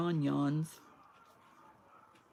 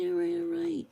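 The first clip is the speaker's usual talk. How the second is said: much higher, playfully giving in; mock-exasperated squeal; 12 semitones higher, 3 dB louder than usual. Real, not acted. Giving in to viewers who voted for wood, in a high squeak. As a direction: squeak it out, conceding the vote.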